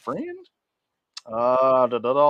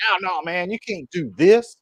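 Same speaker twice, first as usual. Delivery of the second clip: mock Philly complaint; 8 semitones higher, 4 dB louder than usual. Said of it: "They're like".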